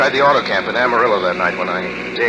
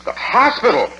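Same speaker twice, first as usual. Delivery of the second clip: shocked; 10 semitones higher than usual. Acted. Shocked at mother in hospital.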